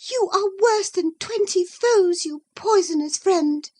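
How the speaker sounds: high, bitter accusation; indignant young woman. Acted. Isabella accusing Catherine, high and bitter. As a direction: spit out poisonous.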